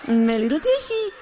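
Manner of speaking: put-on teasing parrot of a child; a light mocking copy of her words; mocking imitation. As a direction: echo it back to make fun of her. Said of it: "You making fun of me?".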